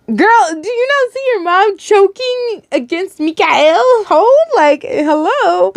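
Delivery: high, drawn-out incredulous scolding. Acted. Reader scolding the heroine over her choking mom, incredulous. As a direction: call out the obvious in disbelief. Aside mocking the heroine's question.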